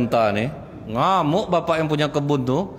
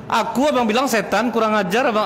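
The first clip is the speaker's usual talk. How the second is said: mock furious wife; 9 semitones higher than usual. Wife raging at her husband for calling her a devil, mock furious. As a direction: lash out at being called a devil. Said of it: "Tuh ngamuk istrinya".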